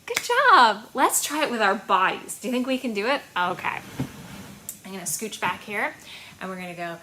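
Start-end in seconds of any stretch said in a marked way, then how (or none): none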